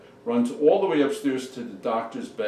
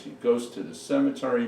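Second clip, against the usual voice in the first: usual speaking voice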